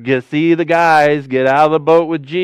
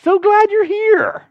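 mock friendly greeting. Preacher mocking demons with an absurdly friendly voice. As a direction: gush a sugary hello, hammed up. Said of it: "I doubt they said that in a friendly voice".

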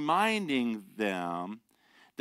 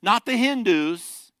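emphatic preaching; 7 semitones higher, 7 dB louder than usual. Acted. Preacher drives contrast home.

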